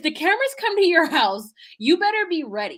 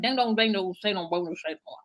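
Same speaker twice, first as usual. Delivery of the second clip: singsong gibberish trailing low and soft; mock nonsense signing; 4 semitones lower, 3 dB quieter than usual. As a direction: imitate garbled signing as babble, dropping away. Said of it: "what they're saying actually sounds like".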